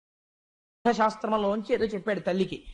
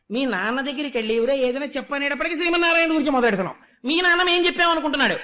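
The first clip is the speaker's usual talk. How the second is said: frantic scolding; 2 semitones higher, 3 dB louder, faster than usual. Acted. Panicked gurus berate young Prahlada, fast and loud. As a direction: rattle off the blame at him, voice rising.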